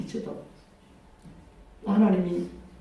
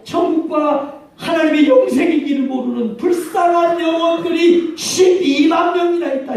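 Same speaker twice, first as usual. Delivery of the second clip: higher, building pleading cry over lost souls; impassioned plea; 7 semitones higher than usual. Retold or imitated. God pleads with Jonah for Nineveh, rising and impassioned. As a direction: rise into an urgent plea for the lost.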